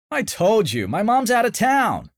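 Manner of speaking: high, breezy confidence; confident reply. A narrator's reading. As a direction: brush off her worry. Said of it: "replied a confident Aiden".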